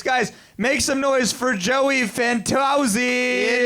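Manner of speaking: slow stretched emcee shout over the crowd; emcee hype call. Real, not acted. Host calls the next comic up to the stage, stretched hype shout.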